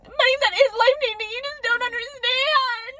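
high, loud squealed insistence; swoony fangirl wail, stretched out; hammed-up, overwhelmed delight; gushing outburst. Gushing over the crush's request.

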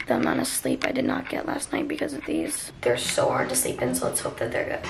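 tired whisper. Real, not acted. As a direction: whisper it, groggy from no sleep. Tired after sleeping in curls.

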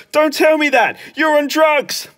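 high-pitched imitation of Mum; scolding mum. Mum confronting Clem in his room, scolding. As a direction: mimic an accusing mother. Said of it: "And she goes".

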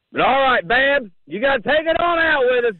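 loud and high, rallying the crowd; hyped up. Real, not acted. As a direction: pump everyone up to close the show. Host rallies call-in guests.

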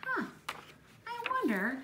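normal talking voice

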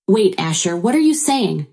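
ordinary delivery

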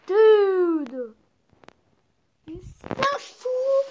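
loud, high-pitched shocked cry; shocked outcry. Real, not acted. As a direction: cry out in disbelief. Shocked at the clown.

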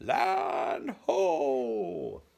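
loud high shout of land sighted; joyful shout; cry out. Acted. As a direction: yell it from the crow's nest. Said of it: "Pete cried".

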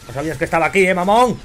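loud mocking jeer at a caught enemy; gloating shout. Real, not acted. Gamer taunting the enemy he ambushed, loud gloating shout.

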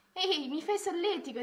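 lightly acted ticklish delight; giggly protest. A narrator's reading. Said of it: "disse Mia ridacchiando". (ridacchiando, divertita)

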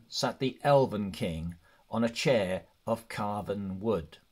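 ordinary delivery

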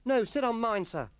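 put-on high, loud boy's plea; begging schoolboy. A narrator's reading. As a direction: beg eagerly in a boy's voice. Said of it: "begged Temple".

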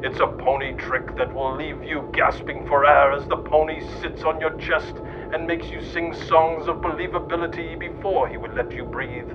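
normal talking voice

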